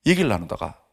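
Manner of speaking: ordinary talk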